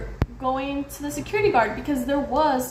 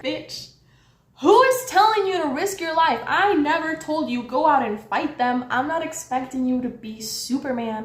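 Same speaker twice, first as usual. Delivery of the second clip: indignant retort; 3 semitones higher than usual. Retold or imitated. Arguing with the guard who won't risk anything, indignant. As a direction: hit him with exasperated disbelief.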